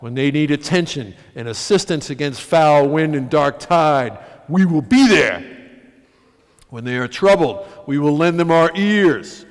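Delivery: loud, measured oratory; formal wedding speech. Acted. A friend speaking to the wedding guests, loud and solemn. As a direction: proclaim it to the gathered crowd with conviction.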